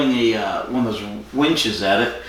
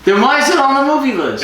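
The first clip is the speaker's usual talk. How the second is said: exasperated; 13 semitones higher, 5 dB louder than usual. Real, not acted. Exasperated at movie list joke.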